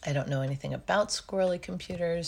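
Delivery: normal talking voice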